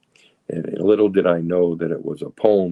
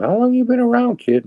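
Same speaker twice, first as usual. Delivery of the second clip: put-on old timer's voice; 6 dB louder than usual. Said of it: "he looked at me and goes".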